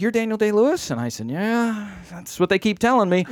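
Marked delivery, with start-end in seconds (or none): 0.0-0.9 higher voice rising in doubt; imitated woman's astonished question, pitched up; incredulous question. Woman asks, not sure. 1.3-3.2 high, drawn-out noncommittal yeah; pitched-up, hedging reply; evasive agreement. Retold or imitated. Evasive answer to woman.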